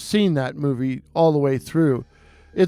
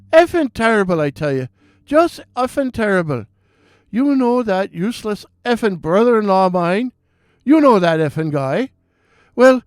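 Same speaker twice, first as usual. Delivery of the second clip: put-on high, excited Irish accent, ranting; an Irish barman venting about his day on the phone; Irish bartender impression; 4 semitones higher than usual. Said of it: "beautiful restful Irish voice".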